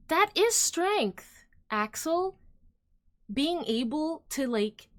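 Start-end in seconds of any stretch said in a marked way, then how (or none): 0.1-2.4 higher, louder and slow, leaning on every word; emphatic, louder. Real, not acted. Streamer correcting Axel about strength, emphatic and slow. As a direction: drive the point home to Axel.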